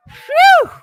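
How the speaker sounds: high, loud whooped phew. Real, not acted. Streamer reacting before reading a message, loud whoop. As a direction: burst out with a piercing whoop.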